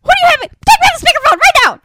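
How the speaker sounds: high-pitched shouted imitation of fury; so pissed. Friend demanding to be off speakerphone, shouted mock fury. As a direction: yell it way up high, speeding up at the end. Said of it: "He is so pissed".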